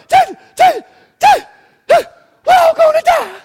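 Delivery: high-pitched, loud, hammed-up panicked shrieking; frantic falsetto screaming for help; panic mode. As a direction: shriek like a terrified disciple in a mock panic. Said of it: "they're like panic mode".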